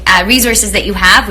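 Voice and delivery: normal talking voice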